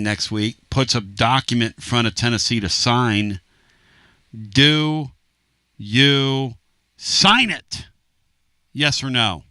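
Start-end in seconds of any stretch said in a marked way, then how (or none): 4.3-7.9 dramatic quiz-show question; drawn-out question. Real, not acted. Poll question to listeners.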